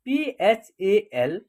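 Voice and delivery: पीएचएएल spelled out very slowly, letter by letter; slow letter spelling. Shown as a demonstration. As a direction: stretch out पीएचएएल for beginners. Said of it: "फल".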